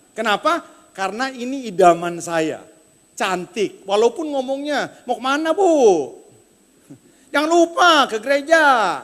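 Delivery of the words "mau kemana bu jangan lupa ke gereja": put-on singsong Tangerang accent. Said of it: "Jadi diayun sedikit".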